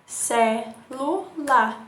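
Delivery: slow, syllable by syllable; syllables drawn out. Shown as a demonstration. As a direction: beat out each syllable. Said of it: "três impulsos de voz". (escandindo devagar)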